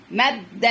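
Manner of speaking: مد split on a doubled د, then open vowel; teacher holding the د in مد before releasing it; doubled-letter demo. Shown as a demonstration. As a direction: sound out مد in two parts. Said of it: "خد منها واحدة".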